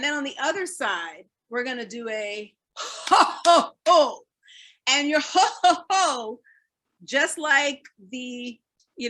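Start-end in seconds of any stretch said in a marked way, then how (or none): 2.8-6.4 loud ho ho from the belly; hearty belly ho ho ho; Santa belly laugh. Shown as a demonstration. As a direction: boom it out like Santa Claus. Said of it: "needs to come from the belly".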